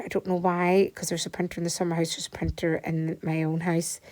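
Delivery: ordinary talk, nothing marked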